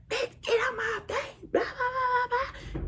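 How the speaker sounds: high squealing mock of his yelling. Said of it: "he started yelling at her".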